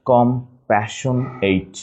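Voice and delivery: slow "compassionate" as com, passion, ate; broken into syllables. Shown as a demonstration. Teacher showing spelling, "compassionate" slowly in pieces. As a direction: break "compassionate" apart, full "ate" ending. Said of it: "just একটু ভেঙে নিব".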